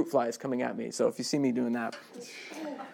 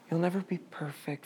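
much softer, "never" gently leaned on; soft reassurance; 3 semitones higher, 8 dB quieter than usual. Retold or imitated. Church soothing the imperfect: soft mock comfort. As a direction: coo it like comfort. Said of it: "and we say".

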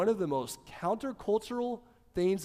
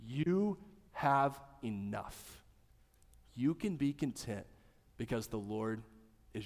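tender reassurance; 5 semitones lower, 5 dB quieter than usual. Real, not acted.

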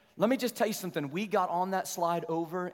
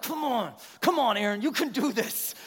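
loud, high self pep talk; psyching himself up; 7 semitones higher, 3 dB louder than usual. Retold or imitated. Dad talking himself into the scary slide, urgent pep talk. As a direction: push yourself on, voice raised. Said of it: "trying to psych myself up".

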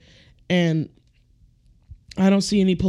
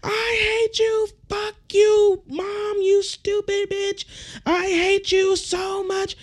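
very high, drawn-out bratty squeal; hammed-up falsetto child tantrum; mock tantrum screaming; 4 dB quieter, slower than usual. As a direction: imitate a kid's screaming fit without the volume. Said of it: "screaming, crying, talk about".

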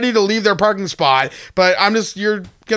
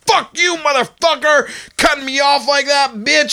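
angry driver; 8 semitones higher than usual. Retold or imitated. Driver pounding on his window, mock angry yell. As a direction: bark it like the furious driver.

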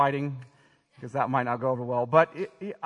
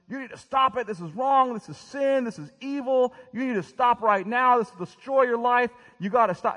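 high, loud, furious retold yelling at his boy; reliving a dad who flew off the handle, shouting; yelling at son; 11 semitones higher, 6 dB louder than usual. Dad catching his son again, yelling much higher and louder. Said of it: "I started yelling at him".

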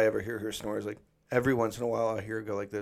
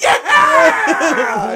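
very high, loud cheer; triumphant shout; 15 dB louder than usual. Retold or imitated. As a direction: cheer like a fan at a race. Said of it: "He goes".

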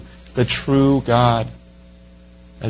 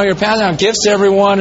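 ordinary talk, nothing marked

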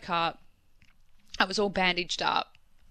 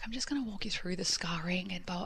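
whispered quote; 7 dB quieter than usual. Retold or imitated. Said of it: "the plastic surgeon was like".

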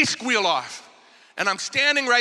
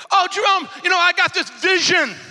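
high, loud, over-eager acting; gushing mock excitement; 8 semitones higher, 5 dB louder than usual. Church member to leader about a vision, gushing high mock excitement. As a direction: gush about a revelation, hammed-up.

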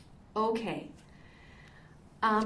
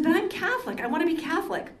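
retold plea, pitched higher; earnest protest; 6 semitones higher, 3 dB louder than usual. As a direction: imitate a man insisting on where he belongs. Retold man protesting he wants to belong.

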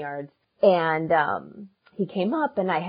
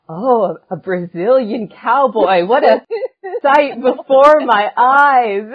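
hammy awestruck; 6 semitones higher, 9 dB louder, slower than usual. Retold or imitated. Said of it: "he's a jokester".

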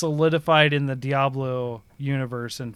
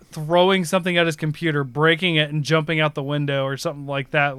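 ordinary delivery

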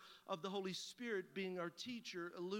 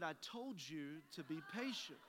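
low, slow voice of reproof; Holy Spirit; 4 semitones lower, 3 dB quieter than usual. Retold or imitated. Holy Spirit chiding the impatient pastor, low and slow. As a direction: deliver a measured, knowing I-told-you-so. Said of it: "And the Holy Spirit said".

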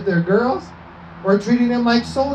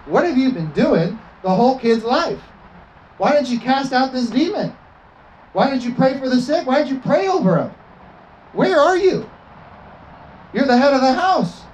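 higher, scolding rebuke to a passive father; 5 semitones higher than usual. Retold or imitated. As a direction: scold him like Jesus rebuking. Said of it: "Jesus would have scolded him".

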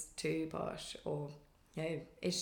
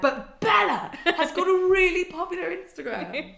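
high, louder mock voice, laughing; mocking the teachers; 11 semitones higher, 8 dB louder than usual. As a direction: parody their baffled pride, hammed-up. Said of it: "And they're like".

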